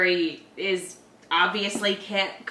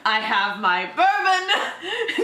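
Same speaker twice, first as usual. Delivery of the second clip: cozy storyteller; 8 semitones higher, 3 dB louder than usual. Pretend. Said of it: "mock storyteller gathering children".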